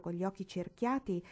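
ordinary delivery

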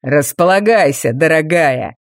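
low, mocking villain voice; menacing villain. A narrator's reading. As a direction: purr it with cold menace. Villain taunts captive.

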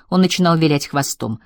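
plain reading voice

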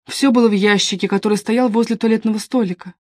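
ordinary delivery

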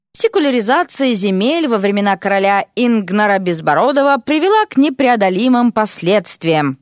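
usual speaking voice

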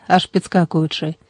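plain reading voice

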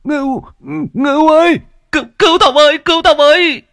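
very high, loud panicked cry for help; desperate plea. Acted. Old man grabbed by the ghost begs the boy to save him. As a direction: beg for your life as hands drag you under. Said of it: "Ông Đại lắp bắp".

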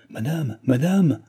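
high, urgent call of alarm; crying out. A narrator's reading. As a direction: call out to warn, pitched high. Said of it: "cria l'hôtesse".

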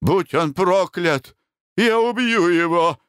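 high, slow angry outburst; frantic, high-pitched fury; raging curse. A narrator's reading. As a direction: spit the threat through tears. Wronged baronet curses in rage.

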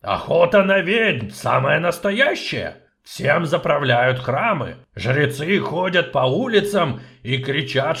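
loud, heavy, slowed dwarf voice; gruff dwarf. Acted. Dwarf explaining the witch hunt, gruff and heavy. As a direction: lay out the grim news.

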